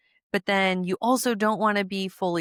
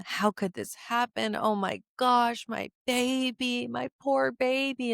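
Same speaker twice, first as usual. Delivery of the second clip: pitched up, hammed-up fretting parent; mock distraught mother, higher voice; overwrought parent; 6 semitones higher than usual. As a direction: wail like a parent making it about themselves. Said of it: "making the emotion really, really big".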